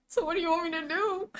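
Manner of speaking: high-pitched exasperated question; helpless, quick high-pitched protest. Retold or imitated. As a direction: ask it fast and high, at a loss. Acted exasperated reply to customers.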